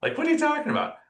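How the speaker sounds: surprised. Retold or imitated. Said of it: "And she's like".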